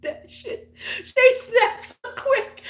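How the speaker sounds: very high, squeaky laughing speech; laughing hard. Real, not acted. Telling how fast her friend snapped, laughing hard. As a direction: squeal it out through real laughter.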